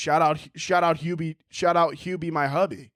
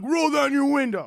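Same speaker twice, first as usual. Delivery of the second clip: higher-pitched mock road-rage order; retold angry demand; 6 semitones higher than usual. Angry driver to the comic at a light, mock angry demand. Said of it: "He got so angry".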